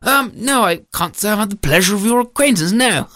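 reply with fussy formality; politely bumbling husband. Acted. Storyteller voices the husband.

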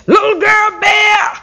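high, slow shouted scream of her name; Mama Bear screaming. A narrator's reading. Mama Bear finds her vegetables missing, high slow scream. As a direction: scream for your girl across the garden. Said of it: "She screamed".

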